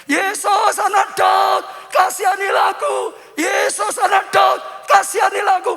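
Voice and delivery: full-force high shout; Bartimeus. Acted. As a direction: cry out for mercy as loud as possible. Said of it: "teriak lebih, lebih keras malah". (teriakan sekeras-kerasnya memohon belas kasihan)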